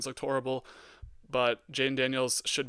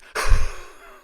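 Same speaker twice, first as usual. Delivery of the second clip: a loud, breathy laugh of delight; gloating laugh; 14 dB louder than usual. Real, not acted. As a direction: laugh hard at the rival's loss. Gloating over Michigan's loss.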